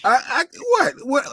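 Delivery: high, squeaky what what; startled squeak. Real, not acted. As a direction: caught out, voice jumps way up. Startled denial of excitement.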